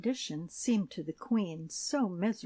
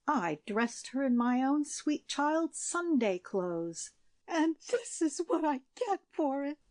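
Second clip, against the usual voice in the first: crying out near tears; 8 semitones higher than usual. A narrator's reading. Shepherdess defending herself to the queen, near tears. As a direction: voice rising toward tears. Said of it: "cried the shepherdess, bursting into tears".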